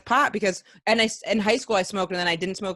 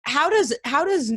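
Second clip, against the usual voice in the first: usual speaking voice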